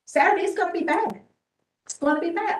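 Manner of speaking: emphatic warning. Real, not acted. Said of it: "I'm a little emotional".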